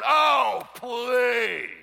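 loud, high mock groan of a fed-up church; groaning crowd. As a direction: groan it out loud like the whole congregation. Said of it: "you could hear the whole church groan".

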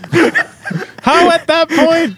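very high, disbelieving squeal; incredulous outburst. Real, not acted. Co-host questioning the storyteller's reaction, incredulous high outburst. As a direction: demand an answer in high-pitched disbelief, drawing it out.

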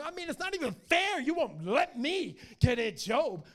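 very high, loud, mocking wail; groveling whine. Satan groveling before the Father over Job, wailing. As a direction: exaggerate a helpless tantrum, stretching the words. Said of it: "here he is groveling in front of the Father".

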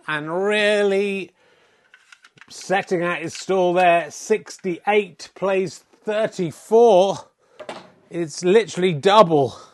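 loud live snooker commentary; excited commentator. Acted. Commentator calling the snooker frame, loud and excited. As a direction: call the frame like a sports commentator.

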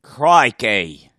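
big loud Aussie crikey; hammed-up Australian exclamation; mock Australian crikey. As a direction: bellow it like a cartoon Australian. Joining the Australian bit.

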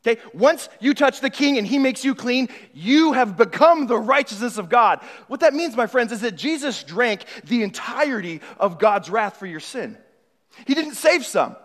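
loud, high passionate preaching; preaching at full force. Acted. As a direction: drive it home to the congregation. Preaching climax.

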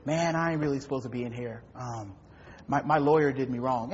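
low, soft, fast inmate excuse; lightly voiced prisoner pleading innocence; mumbling inmate. A hint of the inmate telling the chaplain he was wronged. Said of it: "prison ministry and it was the same thing".

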